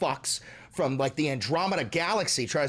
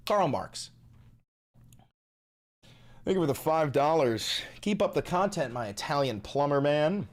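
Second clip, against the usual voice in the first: ordinary talk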